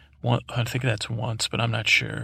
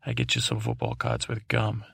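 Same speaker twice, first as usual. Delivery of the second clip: much lower, coaxing villain; sly villain voice; 11 semitones lower, 3 dB quieter than usual. Acted. Villain tempting the podcaster, low coaxing voice. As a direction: lure him in low and sly. Villain bribing narrator with treats.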